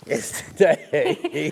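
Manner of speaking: drawn out slowly through a yawn. Real, not acted. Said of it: "I just heard him yawn".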